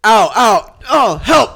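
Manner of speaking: high, loud panicked screams; claustrophobic panic. Acted. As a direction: yell in pain and panic. Improv victim screaming stuck.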